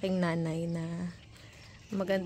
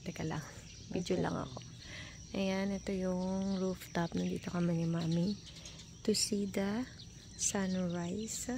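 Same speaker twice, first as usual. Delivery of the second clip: softer hushed tour talk at dawn; hushed morning voice; 2 semitones lower, 10 dB quieter than usual. Real, not acted. Filming the rooftop at sunrise while family sleeps, hushed. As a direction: keep it down so nobody wakes. Early morning, others asleep.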